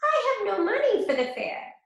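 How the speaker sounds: high little pig; the pig brushing off the wolf. A narrator's reading. Pig refusing the wolf's turnips, high.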